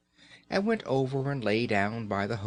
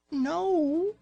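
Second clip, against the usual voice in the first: squeaky mouse voice; 4 dB louder than usual. Acted. Mouse character answers Raggedy Ann.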